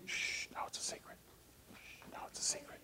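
shh, keep it a secret between us; conspiratorial whisper. Real, not acted. Keeping the answer from children.

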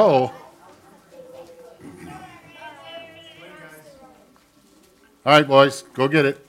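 loud cheerful call. Real, not acted. Calling children out.

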